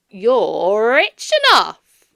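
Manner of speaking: slow, loud, playful retort; nephew retorting. A narrator's reading. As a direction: tease him back.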